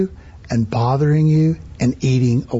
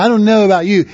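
usual speaking voice

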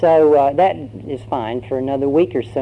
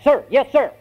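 high, barked recruit reply; drilled recruit; 11 semitones higher, 4 dB louder than usual. Acted. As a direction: snap back an instant answer to the sergeant. Recruit answers the order.

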